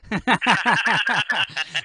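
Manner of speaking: laughing. Real, not acted. Laughs at his joke.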